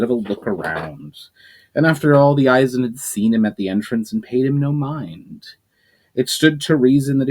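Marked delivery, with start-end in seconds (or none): none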